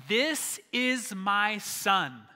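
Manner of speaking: God proclaiming, each word drawn out; booming God's voice. Acted. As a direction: boom it out word by word from the heavens. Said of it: "God's voice came booming out".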